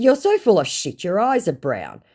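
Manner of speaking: retold jibe, high at the start; mock-scornful comeback; scornful retort. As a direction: act out calling the scammer's bluff. Acts out own retort.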